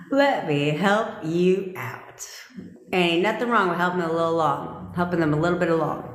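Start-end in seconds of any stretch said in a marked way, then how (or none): 0.1-2.3 drawn out, leaning hard on "Let"; mock-generous, very slow delivery; slow emphatic. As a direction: spell out "Let me help you out." slowly, word by word, full voice. Same words repeated, slow and full.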